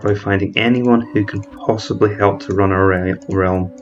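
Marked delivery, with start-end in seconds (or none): none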